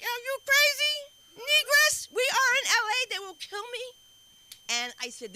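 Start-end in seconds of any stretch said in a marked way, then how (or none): 0.0-3.8 high, loud, slow, panicky outburst; mock panic. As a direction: freak out at the crazy plan. Retold panic at daughter's plan.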